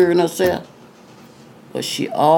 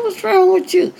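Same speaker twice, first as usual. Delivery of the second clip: startled question. Retold or imitated. Startled at crying girl.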